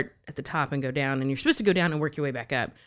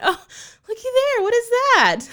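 much higher, playful feigned surprise; high mock surprise; 8 dB louder than usual. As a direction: act like you just found it, high. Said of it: "And I went".

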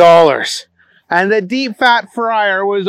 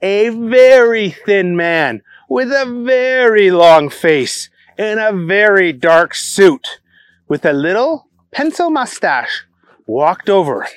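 usual speaking voice

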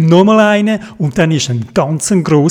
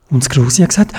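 usual speaking voice

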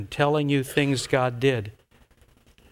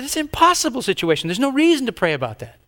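higher, quick dismissal; dismissive retort; 9 semitones higher, faster than usual. Retold or imitated. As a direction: brush the idea off. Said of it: "I said".

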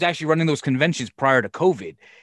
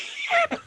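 high burst of laughter. Real, not acted. Laugh at tiny crowd.

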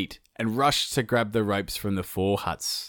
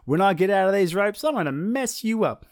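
defiant sun; 10 semitones higher, 3 dB louder than usual. Acted. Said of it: "he was like".

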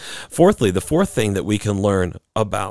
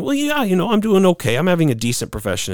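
complacent shrug; 9 semitones higher, faster than usual. Retold or imitated. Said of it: "or even just saying".

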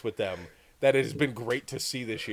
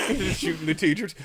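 ordinary talk

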